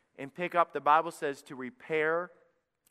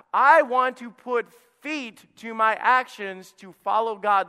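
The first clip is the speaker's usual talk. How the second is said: loud, high and driving, pushing the point home; preaching at full force; 6 semitones higher, 10 dB louder, slower than usual. Acted. Pastor urging his church off the pew, loud preaching. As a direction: preach it hard to the congregation.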